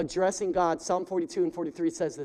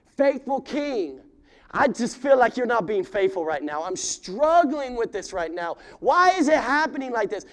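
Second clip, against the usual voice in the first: anguished prayer; 6 semitones higher, 7 dB louder than usual. Acted. Preacher acting out a lament prayer.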